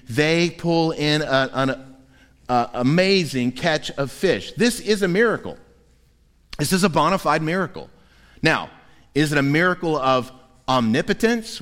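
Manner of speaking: normal talking voice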